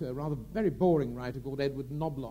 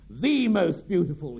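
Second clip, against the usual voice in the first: slow, louder, pointed correction; sharply; 2 semitones higher, 8 dB louder, slower than usual. Retold or imitated. Gielgud correcting Bogart's flattery, slow, loud and sharp. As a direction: pick the word up sharply and savour it. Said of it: "he said sharply".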